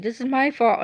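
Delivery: a touch of mock crying; retold crying. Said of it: "I was doing this while crying".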